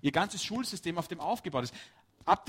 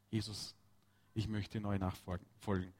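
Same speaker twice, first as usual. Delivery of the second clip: soft prayer; 7 semitones lower, 10 dB quieter than usual. Retold or imitated. Voicing a believer's prayer.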